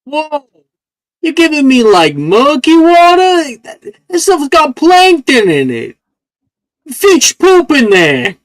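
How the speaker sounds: high, whiny complaining plant. Acted. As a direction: whine about the dirty water. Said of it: "He gets a little whiny".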